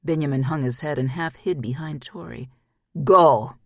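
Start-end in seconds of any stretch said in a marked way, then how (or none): 3.0-3.6 booming shout of a single word; a huge booming order. Acted. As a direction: bellow the command. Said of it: "Klaus boomed".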